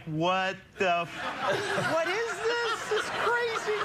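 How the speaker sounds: high, squeaky mock-shocked mother; shocked mom. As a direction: squeal it like a mom finding something awful. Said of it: "she was like".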